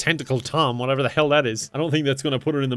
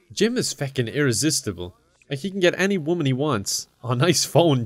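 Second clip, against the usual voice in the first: ordinary delivery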